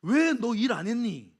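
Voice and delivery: loud, drawn-out reprimand; scolding. Acted. Master rebuking the idle servant, loud scolding. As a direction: scold him loudly and slowly. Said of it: "뭐라고 책망하는가".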